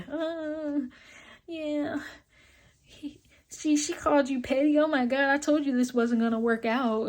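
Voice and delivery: high, mocking voice of the boyfriend laughing downstairs. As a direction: mimic the boyfriend's high, snickering told-you-so.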